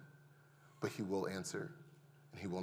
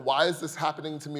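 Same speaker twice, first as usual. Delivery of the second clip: higher, louder anguished cry; yelling at God; 4 semitones higher, 6 dB louder than usual. Retold or imitated. Father shut out of hospital yells at God, anguished. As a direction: shout the question at the sky. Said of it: "I am yelling at God".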